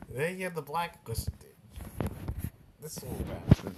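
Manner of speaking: helpless wheezy laughter through the words; words collapsing into real laughter; laughing. Real, not acted. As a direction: try to talk while cracking up. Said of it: "I don't know why I'm laughing".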